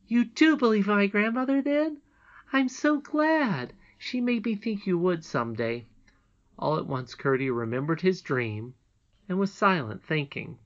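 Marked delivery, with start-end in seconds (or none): 0.1-5.8 high, emphatic little princess; overjoyed, pitched well up; delighted princess. A narrator's reading. Princess glad that Curdie believes her, delighted.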